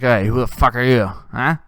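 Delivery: loud aggressive challenge, demanding who he is; angry confrontation. Retold or imitated. Husband at the bar confronting a stranger, angry loud challenge. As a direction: bark it at the stranger like a drunk husband.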